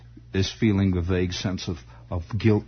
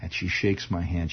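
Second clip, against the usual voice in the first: normal talking voice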